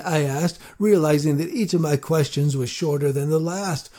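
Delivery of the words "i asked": plain reading voice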